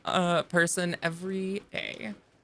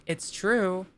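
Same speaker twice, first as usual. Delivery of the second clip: normal talking voice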